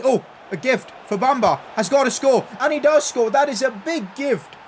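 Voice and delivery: high, loud burst of goal excitement; real excitement as the striker scores; excited goal call. Real, not acted. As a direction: call the goal like a commentator losing it. Goal scored in live commentary.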